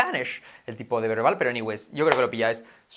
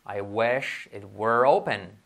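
very slow, drawn-out English example; slow model sentence; 4 semitones lower, much slower than usual. Shown as a demonstration. Teacher models English example for learners, slowly. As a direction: spell out each word unhurriedly.

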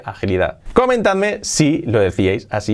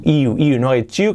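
ordinary delivery

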